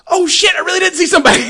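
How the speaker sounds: high, loud, fast laughing yell; mock panicked shout. Kid shrieks after seeing Michael in the closet. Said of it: "And the kid's like".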